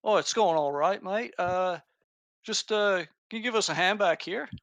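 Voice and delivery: friendly Cockney npc asking for help; Cockney worker calling out mildly; Cockney accent. Acted. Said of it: "new accent".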